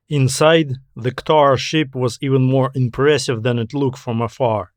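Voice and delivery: ordinary delivery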